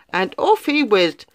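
plain reading voice

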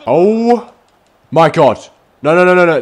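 loud, high panicked no's; alarmed cry. Real, not acted. Gamer watching the opponent score, loud panic. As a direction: cry out as the goal goes in.

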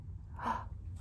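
quick whispered gasp; soft gasp. Pretend. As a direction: breathe in sharply, amazed. Reaction to the warning.

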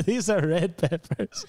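high, slow imitation of an Ecuadorian accent; mock Ecuadorian cook, drawn out. Cook corrects a teasing coworker, high Ecuadorian accent. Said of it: "dude from Ecuador".

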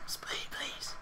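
breathy whisper, almost silent; mock hushed begging; whispered echo. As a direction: murmur the plea back in a whisper.

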